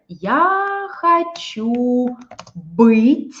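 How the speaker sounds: stretched very slowly as a model sentence; slow teacher's example; slow drawn-out demo. Shown as a demonstration. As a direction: draw out each word for the learners. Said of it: "Например".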